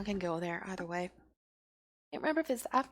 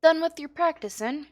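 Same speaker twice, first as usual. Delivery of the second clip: lively, louder in-character dialogue read; lightly voiced rogue mentor; 6 semitones higher, 10 dB louder than usual. Guild rogue to new recruit after practice, lightly in character.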